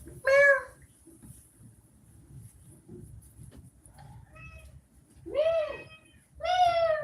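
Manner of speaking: squeaky imitated cat meows; high meows. Real, not acted. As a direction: mew like a tiny kitten. Meowing at the cat.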